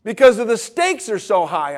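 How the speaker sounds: worked-up insistence; emotional. Real, not acted. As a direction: answer back with real feeling. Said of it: "it's an emotional issue".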